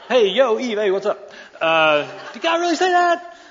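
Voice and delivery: a goofy high-pitched bro snake greeting Eve; casual snake. Acted. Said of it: "my snake was so casual".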